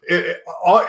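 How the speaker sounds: normal talking voice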